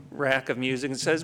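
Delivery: usual speaking voice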